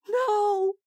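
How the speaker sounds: a sudden high panicked cry; terrified shriek of refusal; hoarse scream. A narrator's reading. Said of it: "The word was a hoarse scream".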